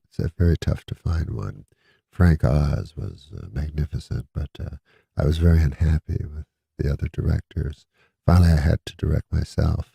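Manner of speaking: lightly voiced, low and calm zen tone; peaceful Eddie Murphy. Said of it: "he goes, real peacefully".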